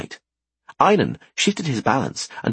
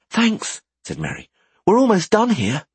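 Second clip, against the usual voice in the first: put-on higher, brighter girl voice; young woman voice; 11 semitones higher than usual. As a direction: play Merry, lively. Merry's voice.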